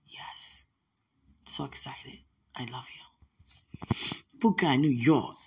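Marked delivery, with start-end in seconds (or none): 0.1-3.0 soft hushed whisper; whispered love. Real, not acted. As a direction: breathe the words softly. Whispered farewell.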